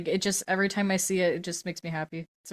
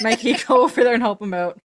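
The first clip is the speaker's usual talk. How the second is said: laughing; 3 semitones higher, 8 dB louder than usual. Real, not acted. Teasing friend about butt photo.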